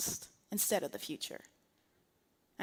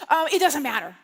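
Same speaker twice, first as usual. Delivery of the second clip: louder, insistent mock indifference; reacting to frustration; 8 dB louder than usual. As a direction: insist firmly that you don't care. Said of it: "can you ever feel the frustration".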